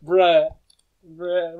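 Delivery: loud, drawn-out disbelief; a loud groaning bruh; exasperated bruh. Real, not acted. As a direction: hurl out a fed-up bruh. Reacting to game scene.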